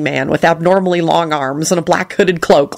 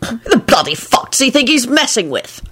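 higher, indignant swearing; outraged Lupin; 6 semitones higher than usual. A narrator's reading. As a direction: fume at the insult.

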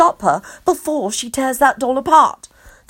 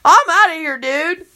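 announcing; 6 semitones higher, 4 dB louder than usual. A narrator's reading. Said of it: "Kenny announces".

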